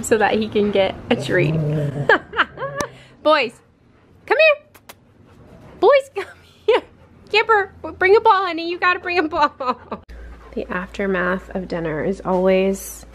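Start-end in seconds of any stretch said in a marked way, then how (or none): 3.2-10.0 very high, loud sing-song call; laughing through a high-pitched call; calling both dogs in a very high voice. Real, not acted.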